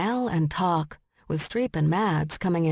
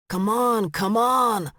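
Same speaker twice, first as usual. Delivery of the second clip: urgent raised yell, drawn out; 5 semitones higher, 4 dB louder, slower than usual. A narrator's reading. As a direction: yell it higher, urging someone along. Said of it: "he yelled".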